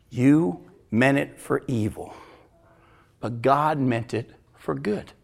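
solemn, slow. Acted. Joseph's solemn reply.

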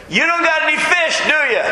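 high, mocking call from the shore; taunting stranger. Shown as a demonstration. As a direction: tease them like a smug onlooker. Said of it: "That's literally how it reads".

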